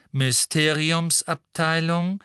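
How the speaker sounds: slow, detached lift announcement; cool female announcer. A narrator's reading. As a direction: announce the floor coolly and slowly. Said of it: "sagte die kühle Frauenstimme".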